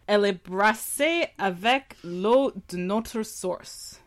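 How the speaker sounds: careful slow French reading. Shown as a demonstration. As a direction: read the label aloud in French. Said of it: "Read it en français".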